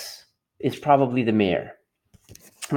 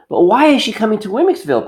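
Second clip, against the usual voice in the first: higher, fast puzzled question; Punchinello voice; 5 semitones higher, 4 dB louder, faster than usual. Acted. Punchinello pressing the mayor's wife, high and quick. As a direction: ask eagerly like a small wooden boy.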